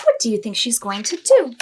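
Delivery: usual speaking voice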